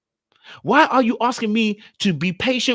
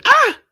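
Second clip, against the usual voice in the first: short high-pitched shriek; sudden piercing mock yelp; imitated girl's yelp; 7 dB louder than usual. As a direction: mimic a startled squeal, loud and high. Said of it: "and she goes".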